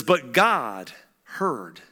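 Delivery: very slow, weighty reading; a slow, solemn reading of God's mercy; dramatic slow reading. Acted. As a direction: let each word land.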